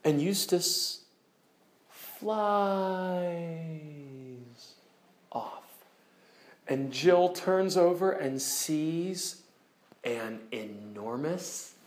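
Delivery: almost whisper the reveal; hushed awe. A narrator's reading. Dramatic reveal of the lion.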